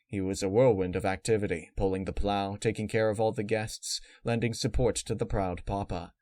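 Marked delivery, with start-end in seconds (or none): none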